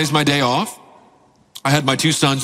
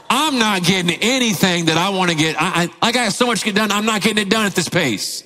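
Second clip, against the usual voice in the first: higher-pitched mock exasperation; irritated; 6 semitones higher than usual. Dad held up by son's help, irritated. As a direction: retell a fed-up complaint, pitch raised. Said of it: "I'm getting irritated".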